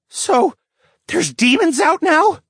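high, startled question; wide-eyed wonder. Acted. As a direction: ask it wide-eyed and alarmed. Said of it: "Pratt wondered with wide eyes".

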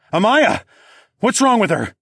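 loud, high, frantic; panicked shout. Acted. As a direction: cry out in alarm. Narrator panics as she convulses.

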